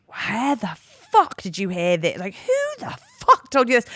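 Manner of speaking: drawn-out, hammed-up outraged demand; imitated telling-off. Friend chewing out someone over a rumour, imitated indignant telling-off. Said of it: "absolutely chewed him out".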